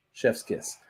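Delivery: normal talking voice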